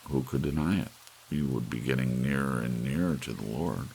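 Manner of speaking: plain reading voice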